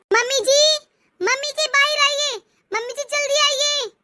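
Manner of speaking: बाहर से पुकारती, ऊँची आवाज़ में; urgent calling out. Acted. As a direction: call her out, high and pressing. Daughter-in-law calls urgently from door. (Mummy जी को जल्दी बाहर बुलाती बहू, बेचैन पुकार)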